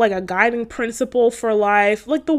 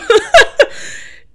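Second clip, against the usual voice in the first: laugh; 9 dB louder than usual. Real, not acted. Nervous aside about hypothetical.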